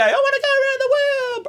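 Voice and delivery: very high wailing mimicry; hammed-up shrieky cry; imitated ward patient. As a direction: screech it like the lady on the ward. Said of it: "This lady would come around every day".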